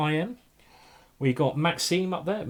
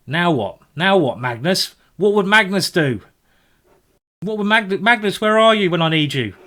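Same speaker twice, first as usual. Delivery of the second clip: louder, higher plea to Magnus; exasperated calling out; 5 semitones higher, 8 dB louder than usual. Real, not acted. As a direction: cry out to him for help, half joking.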